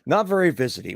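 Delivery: high, loud disbelieving repeat; incredulous echo. Real, not acted. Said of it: "What does that mean exactly?".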